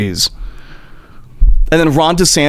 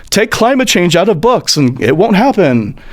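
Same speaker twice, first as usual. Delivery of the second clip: ordinary delivery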